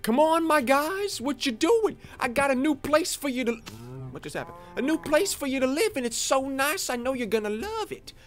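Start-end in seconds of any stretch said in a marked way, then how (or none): 0.0-3.5 high, sing-song coaxing voice; coaxing the animals. Real, not acted. Coaxing cows into new pen. 4.7-8.1 high, sing-song coaxing voice; coaxing the animals. Real, not acted. Coaxing cows into new pen.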